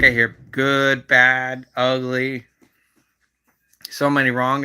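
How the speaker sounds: usual speaking voice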